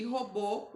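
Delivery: robô with BÔ stressed; stress on final syllable. Shown as a demonstration. Teacher shows pupils the strong syllable, robô stressed on BÔ. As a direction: lean on the last syllable of robô. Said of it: "o bo".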